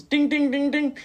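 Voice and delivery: high, repeated machine chimes; high-pitched ding-ding noises; imitated slot-machine dings. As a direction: mimic a slot machine dinging.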